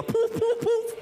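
quick little high puffs of sound; high-pitched poofs. Retold or imitated. Preacher mocking drawn-on eyebrows to congregation, high-pitched poofs. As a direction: poof it out high.

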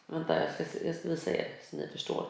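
drops to a soft, breathy near-whisper; hushed aside. Real, not acted. Vlogger to viewers, hushed while fetching the app. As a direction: mutter it half to herself while reaching for the phone.

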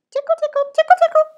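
very high, loud tickle, tickle; shrill playful tickling chant; squeaky tickle. Acted.

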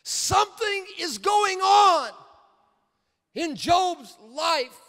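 slow, climbing to a high loud shout; drawn-out preacher's rising cry; preaching at full force. Acted. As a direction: build the sermon to its peak. Preaching builds to climax.

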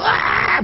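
high, exaggerated crying shriek; mock wail. Narrator mimicking three crying dwarfs, mock wail. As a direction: break into a brief high mock bawl.

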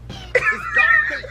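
loud laughing disbelief; shocked shout. Real, not acted. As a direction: yell it out in shock. Shocked at ugly character.

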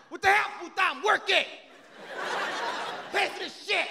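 high, loud mock husband shout; drunk husband yelling. Husband shouting back at the wife, mock yell. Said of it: "He's like".

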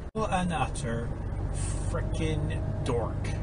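soft, slow muttered insult; muttering. Real, not acted. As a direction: mutter it under your breath. Muttering about another driver.

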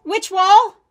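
high, loud, unsure call from afar; from another room. Acted. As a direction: call up from the basement, confused. Calling from the basement.

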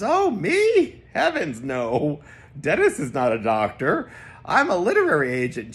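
high loud fluttery oh me; a hammed-up genteel denial; prissy mock modesty. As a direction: play it dainty and scandalised.

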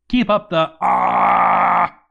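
loud startled shout cutting off the sentence; sudden yell. Real, not acted. Narrator suddenly shouts mid-read. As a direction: break off mid-line into a loud cry. Reading cut off by sudden scream.

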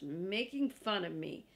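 ordinary delivery

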